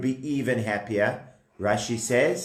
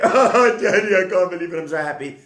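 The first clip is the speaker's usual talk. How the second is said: crying for joy; 4 semitones higher, 3 dB louder, faster than usual. Acted. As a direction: burst out tearfully happy, then settle. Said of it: "He was so happy he was crying".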